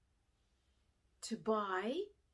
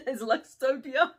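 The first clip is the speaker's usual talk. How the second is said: laughing while saying it, louder; 5 semitones higher, 12 dB louder than usual. Real, not acted. Teacher recalling the printed wrapping paper, laughing. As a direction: chuckle through the words.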